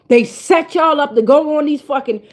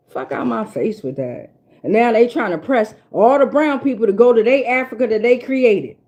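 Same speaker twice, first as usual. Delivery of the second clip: ordinary delivery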